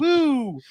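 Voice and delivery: high, drawn-out "woo"; whooped imitation. Imitating a Super Troopers character who thinks he looks hot, high whoop. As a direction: let out a high mock whoop. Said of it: "he's like".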